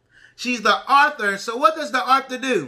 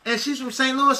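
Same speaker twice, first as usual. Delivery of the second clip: normal talking voice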